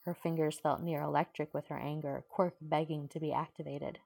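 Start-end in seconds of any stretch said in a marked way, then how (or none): none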